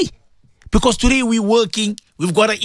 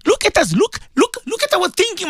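high exasperated call; 11 semitones higher than usual. Real, not acted. Preacher rebuking his listeners.

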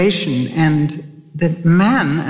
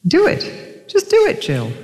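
much higher, pushy husband's urging; 12 semitones higher than usual. Retold or imitated. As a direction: push her on like an impatient husband. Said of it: "he did what he usually does".

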